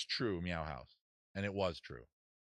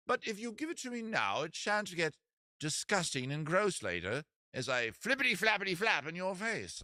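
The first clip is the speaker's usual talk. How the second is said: high, fussy British seagull; British accent; 6 semitones higher than usual. Acted. Seagull bargaining for the hot dog, British accent. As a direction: argue your case in a high mock British accent. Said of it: "give you a British accent".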